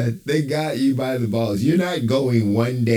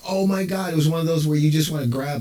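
ordinary delivery

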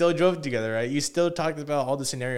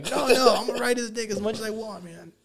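pitched-up mock protest with a laugh; 8 semitones higher than usual. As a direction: throw the friend's defiance back at him, laughing. Mocking friend's reply.

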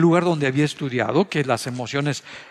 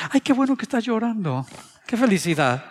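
mock cheerful; 8 semitones higher than usual. Mock reaction to spouse crying.

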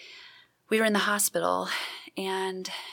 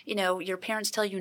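ordinary talk

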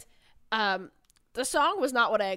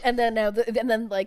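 ordinary talk

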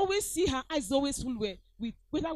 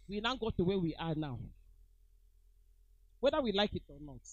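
ordinary talk

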